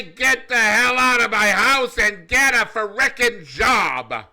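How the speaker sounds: loud, high exasperated parent yell. Pretend. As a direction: bellow it like a fed-up parent. Parent yelling at streaming kid.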